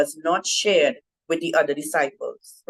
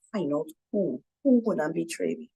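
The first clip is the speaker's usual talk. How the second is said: hushed aside; 6 dB quieter than usual. Retold or imitated. Peter secretly signals John.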